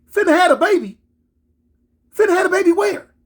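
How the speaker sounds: high-pitched incredulous questioning; retold shock, voice jumping up; acted disbelief. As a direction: echo the news in mounting disbelief.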